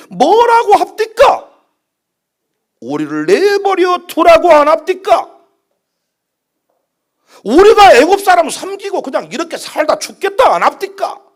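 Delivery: high-pitched, reproachful rhetorical questions; indignant complaint. Acted. Israelites complaining to Moses in the wilderness, indignant.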